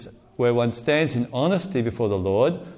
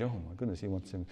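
much softer, hushed amazement; crowd amazed; 4 semitones lower, 12 dB quieter, faster than usual. Retold or imitated. Crowd amazed that Jesus wants the beggar, hushed surprise. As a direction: murmur it in quiet surprise. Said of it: "the crowd changed".

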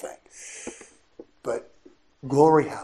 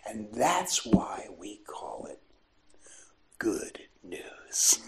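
gentle reassurance; 3 semitones lower, 4 dB quieter than usual. Retold or imitated. Priest consoling the weeping prostitute, soft whisper. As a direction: hush it to her tenderly.

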